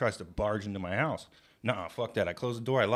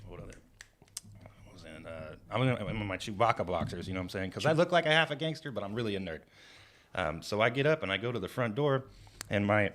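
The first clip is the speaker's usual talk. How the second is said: ordinary delivery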